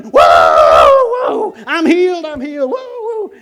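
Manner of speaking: high, very loud whooping; wild, elated whoops and cries; jubilant shouting. Retold or imitated. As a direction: imitate a healed man yelling for joy. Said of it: "and he's shouting".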